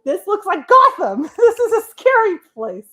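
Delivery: high, loud, laughing disbelief; hammed-up alarm breaking into laughter; mock alarm. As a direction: exclaim it in comic horror. Comic alarm at the city.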